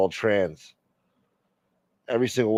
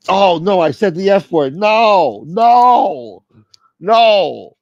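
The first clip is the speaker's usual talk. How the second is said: real alarm; 10 semitones higher, 12 dB louder, much slower than usual. Real, not acted. Realises he swore on air.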